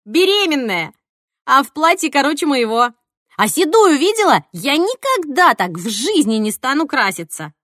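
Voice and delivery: loud, high, scandalised chatter; brash outrage; gossipy exclaiming. A narrator's reading. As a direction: shout juicy gossip at your friend. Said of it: "гаркнула мне в ухо Марина".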